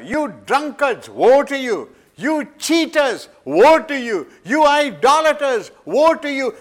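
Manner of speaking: high, loud prophetic denunciation; a lightly voiced thundering prophet; prophet pronouncing woes. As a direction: condemn each one in turn. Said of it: "Isaiah goes here".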